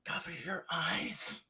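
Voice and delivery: slow, hushed stage whisper. Acted. Character secretly warning Polly during a distraction, whispering. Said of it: "And then I'll whisper to Polly".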